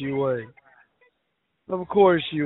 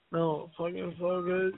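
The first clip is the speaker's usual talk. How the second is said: usual speaking voice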